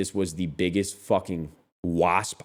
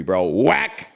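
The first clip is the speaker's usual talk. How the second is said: ordinary delivery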